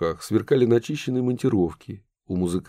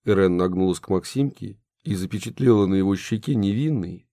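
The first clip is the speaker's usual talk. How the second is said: plain reading voice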